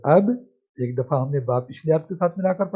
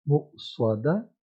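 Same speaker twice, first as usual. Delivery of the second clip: slow syllable blending; much slower than usual. Shown as a demonstration. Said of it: "جب توڑ کرتے ہیں".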